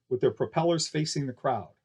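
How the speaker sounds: plain reading voice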